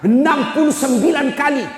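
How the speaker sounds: high, ringing stress on puluh; emphatic stress. Real, not acted. Preacher warning the men how hot hellfire is. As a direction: hammer home the number.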